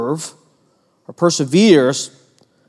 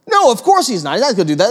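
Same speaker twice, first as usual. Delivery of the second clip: usual speaking voice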